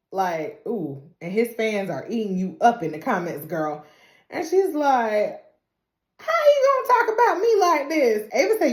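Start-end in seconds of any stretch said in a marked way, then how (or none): 6.2-8.3 high, loud indignant complaint; hammed-up outrage. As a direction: demand it, offended.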